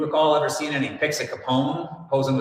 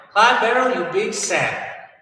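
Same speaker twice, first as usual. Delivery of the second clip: put-on high, loud old-time Southern Bonnie; scolding Bonnie in a 1930s Southern twang; 1930s Southern accent; 10 semitones higher, 4 dB louder than usual. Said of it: "anywhere close to their accents".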